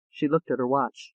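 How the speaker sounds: plain reading voice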